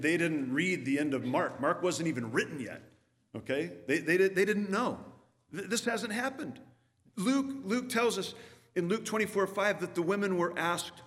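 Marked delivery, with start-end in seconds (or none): none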